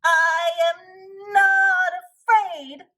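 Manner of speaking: high, slow, shaky cry of false courage; trembling cry. Acted. Shaking Toad insisting to Frog, high frightened cry. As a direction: cry out, pretending not to be scared. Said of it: "he cried".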